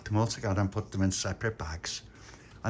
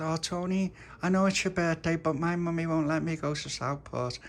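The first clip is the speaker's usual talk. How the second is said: put-on little girl; 8 semitones higher than usual. Little Dolores asks her mummy.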